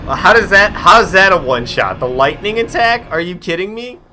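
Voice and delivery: loud, high disbelieving yell; outraged shouting. Real, not acted. Streamer yelling at the game over an unfair one-shot, outraged. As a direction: shout it like you can't believe it just happened.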